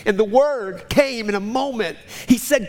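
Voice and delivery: normal talking voice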